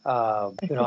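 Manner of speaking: ordinary delivery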